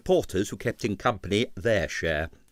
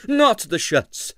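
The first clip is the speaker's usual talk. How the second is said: slow, petulant refusal; sulky and slow; 11 semitones higher, 5 dB louder, slower than usual. A narrator's reading. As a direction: Navajno brushing off Stroganov, petulant. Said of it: "said Navajno petulantly".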